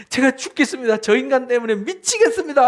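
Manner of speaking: high-pitched mock lament to God; lightly hammed-up exasperation; acted desperate plea. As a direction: complain as if at wit's end.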